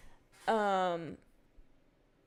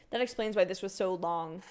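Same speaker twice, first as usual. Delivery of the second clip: ordinary delivery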